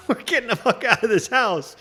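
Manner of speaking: high, laughing rowdy crowd; laughing mock shout. Crowd breaking out of lockdown, laughing. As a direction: laugh through it like people bursting out. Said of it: "It's just gonna be like".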